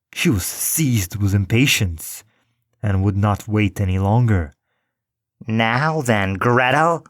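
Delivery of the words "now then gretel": impatient witch. A narrator's reading. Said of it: "she cried to the girl".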